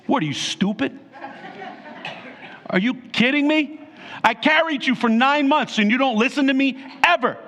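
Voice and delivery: high-pitched South Jersey nagging; South Jersey accent. Acted. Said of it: "I'll say it like South Jersey".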